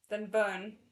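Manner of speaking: cold threat. Acted. Chuaning threatens with fire.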